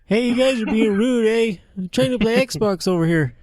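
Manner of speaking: very high cartoon Canadian whine sliding into Mexican; hammed-up Canadian gamer drifting into Mexican; Canadian accent turning Mexican. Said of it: "your Canadian accent becomes Mexican".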